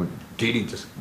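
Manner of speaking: normal talking voice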